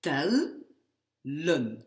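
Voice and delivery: tellen as a firm tel, pause, len; closed syllable. Shown as a demonstration. Teacher recapping for students, tellen syllable by syllable. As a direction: split tellen after the L. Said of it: "il faut que ma syllabe soit fermée".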